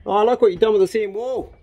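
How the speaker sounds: loud, high delighted praise; excited. Real, not acted. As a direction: burst out with real delight. Excited praise of friend's work.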